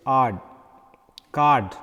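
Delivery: ard, then card, with a long ah; long vowel in card. Shown as a demonstration. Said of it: "word is pronounced like this".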